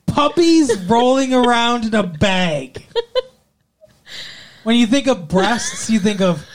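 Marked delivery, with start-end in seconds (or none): 0.0-2.9 slow, higher, louder repeat of the phrase; incredulous repeat. Real, not acted. Throwing the co-host's breast comparison back at her, slow and incredulous. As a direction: say it back like it's absurd.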